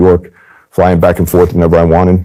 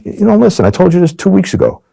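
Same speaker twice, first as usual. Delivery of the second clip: much higher, rising indignant rant; exasperated scolding; 14 semitones higher than usual. Retold or imitated. Agent turning the tables on the mobster: high indignant rant. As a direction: pile on the guilt like a nagging friend.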